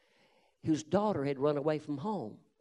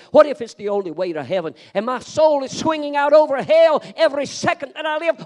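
louder and louder, building to higher pitch; preaching at full force; 5 semitones higher, 14 dB louder than usual. Acted. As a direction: drive the questions home like a revival preacher. Preaching climax.

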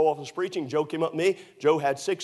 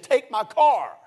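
high, loud demand; insistent command; 9 semitones higher, 6 dB louder, much slower than usual. Retold or imitated. Joe ordering the young preacher to take his car, loudly. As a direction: push the order hard. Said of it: "He said".

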